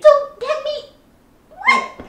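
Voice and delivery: high, loud shocked disbelief; can't believe what he just said; outraged disbelief. Acted. Sister hears she hurt the kid, shrieking in disbelief.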